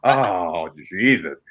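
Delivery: normal talking voice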